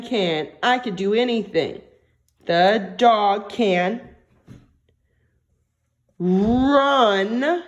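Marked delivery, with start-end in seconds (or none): 2.5-7.6 each word drawn out slowly while writing; stretched out. Shown as a demonstration. Teacher writing on the board, stretching out each word. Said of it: "Stretch it out".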